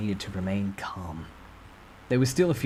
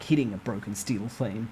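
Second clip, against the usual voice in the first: usual speaking voice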